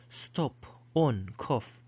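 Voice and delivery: rounded British O in each word; British "stop", "on", "cough" with short o; British pronunciation. Shown as a demonstration. Teacher comparing back to British English, British vowel demonstrated. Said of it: "that's the British way of pronouncing it".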